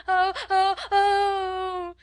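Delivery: high, loud sustained wail; loud acted "oh-oh-oh" howl of a child; child's howling wail. A narrator's reading. Small girl refusing to be silenced, howling wail. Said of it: "Lottie howled tempestuously".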